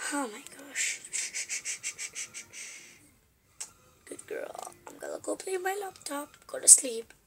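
barely audible bedtime murmur; hushed mom voice. Acted. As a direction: speak very softly beside a sleeping baby. Mom hushes baby to sleep.

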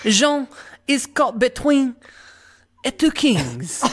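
high, hammy French narrator; French accent. Pretend. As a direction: go full-blown French. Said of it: "full-blown French at this point".

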